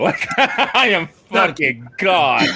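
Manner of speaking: laughing, high and drawn-out. Real, not acted. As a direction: say it slowly through laughter. Player laughing about fleeing.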